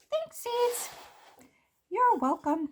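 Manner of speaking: high, bright cartoon voices; squeaky cartoon exchange; chirpy thanks. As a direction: trade thanks like cheerful little seeds. Characters thank the seeds.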